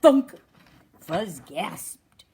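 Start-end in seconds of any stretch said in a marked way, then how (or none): 0.0-0.3 sharp, much louder thunk. Acted. Narrator sounding the pine cone hitting Fuzz, loud thunk. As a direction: bang it out like a hit on the head.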